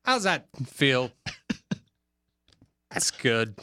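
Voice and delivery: Christopher Walken impression. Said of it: "Christopher Walken".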